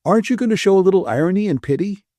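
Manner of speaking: quick playful needling; teasing Bill. A narrator's reading.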